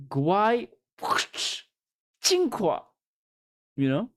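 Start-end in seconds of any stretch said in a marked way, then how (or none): 0.1-2.8 Guai Qinghua said high with a laugh, Qinghua pitched way up; playful laughing show-off of the phrase Guai Qinghua; laughing Chinese. Shown as a demonstration. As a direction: giggle through the Chinese words Guai Qinghua. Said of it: "I know".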